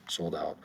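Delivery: ordinary delivery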